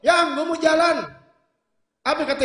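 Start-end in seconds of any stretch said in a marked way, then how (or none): 0.0-1.0 loud call, pitched well up; shouting from the door. Retold or imitated. Husband shouting from the door to his wife, loud call. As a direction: yell it across the house to the wife. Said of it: "Lakinya teriak dari pintu".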